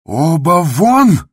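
very high, loud, drawn-out yell; enraged, stretched bellow at full pitch; furious shout. A narrator's reading. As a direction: scream them out of the room. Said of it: "продолжал кричать Андрей Ефимович".

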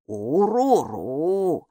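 meaningful grumble. Acted. Бука, лесное существо, многозначительно ворчит «Уруру» Майке. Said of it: "снова повторила Бука".

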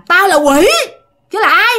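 high, defiant retort; acted demon-child answering back; possessed boy as demon.

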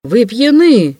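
high exclamation of shock; recoiling cry. A narrator's reading. Host recoiling from a drunk guest's request, startled cry. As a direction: cry out, taken aback, pitch leaping up. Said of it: "закричал тот и отшатнулся".

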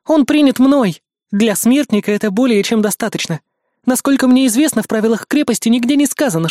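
high, firm, insistent noblewoman; commanding countess. Acted. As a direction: press the point, haughty and firm. Countess argues with priest.

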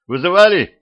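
high, loud call through the door. A narrator's reading. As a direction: raise your voice to be heard. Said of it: "громко сказал он".

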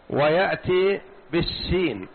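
normal talking voice

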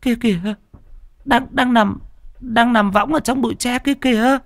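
high, frightened wife pointing; a wife stammering in fear at a shape in the bamboo; terrified whisper-cry of a wife; trembling terrified wife. A narrator's reading. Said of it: "Bà run run chỉ bụi tre".

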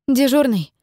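dispatcher calling over the radio; over walkie talkie. Acted. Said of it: "Но её рация зашипела".

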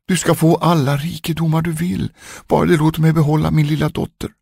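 high, fast desperate begging; queen begs the dwarf to keep her daughter, pleading high and fast. Acted. Queen pleads with the dwarf for her daughter, high and rushed. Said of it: "grät och bar sig åt och bad".

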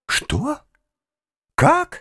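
two high, startled questions; astonished questions. A narrator's reading. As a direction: jump up in pitch in disbelief. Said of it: "спросил конректор Паульман". (изумлённо переспрашивая)

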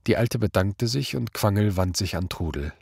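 plain reading voice

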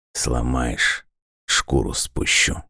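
low, soft, hissing threat; menacing villain. A narrator's reading. Koschei's threat quoted.

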